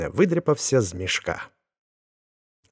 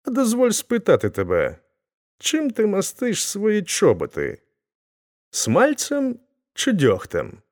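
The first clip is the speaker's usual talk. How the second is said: much higher-pitched enquiry; awkward polite question; 11 semitones higher than usual. A narrator's reading. Chub asks the wrong question by mistake, high awkward enquiry. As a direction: ask it high, drawing out the end. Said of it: "Він хотів не те сказати".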